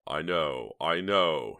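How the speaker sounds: usual speaking voice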